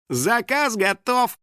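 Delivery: high, slow, gleeful call; smirking announcement. A narrator's reading. House spirit announces the knockout, smirking. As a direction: announce it in a drawn-out singsong smirk. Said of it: "с усмешкой объявил домовой".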